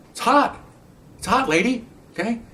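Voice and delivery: mock whiny mother talking to the cop; defensive excuse. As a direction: brush the officer off.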